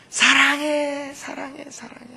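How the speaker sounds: high echo, fading to nearly nothing; 메아리 imitation. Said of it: "메아리가 이번에는 무슨 소리로 대답할까요". (점점 작아지는 높은 메아리)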